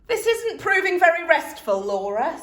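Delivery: loud, high cross complaint. A narrator's reading. Tired mum scolding daughter, cross. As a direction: scold her, exasperated. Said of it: "said Missus Large crossly".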